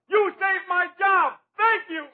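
high, slow, tearful shout of thanks; overjoyed shout. Acted. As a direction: cry out in grateful joy. Cop overjoyed at the saved job.

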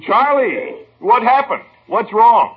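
high, agitated questions down the phone; alarmed. Acted. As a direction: demand news in a rising panic. Alarmed phone call about water failure.

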